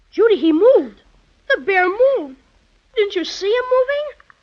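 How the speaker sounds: loud, urgent cry to his sister; excited. Acted. Boy telling his sister the toy bear moved, loud and excited. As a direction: blurt it out, thrilled and startled.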